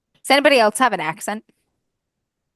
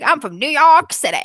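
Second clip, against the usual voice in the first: put-on New York accent; 7 semitones higher, 6 dB louder than usual. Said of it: "Cassidy's like".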